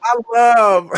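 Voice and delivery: high laugh through words. Real, not acted. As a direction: burst into delighted laughter. Delighted laugh.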